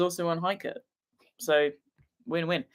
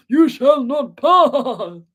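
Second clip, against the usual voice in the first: booming wizard; 7 semitones higher, 8 dB louder, much slower than usual. Pretend. Booming quote after cat voice.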